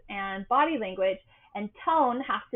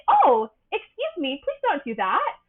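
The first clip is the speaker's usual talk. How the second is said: sunshine tone; 7 semitones higher, 5 dB louder than usual. Shown as a demonstration. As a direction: set it sweetly in a pitched-up cheery voice. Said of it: "use your natural tone and just say".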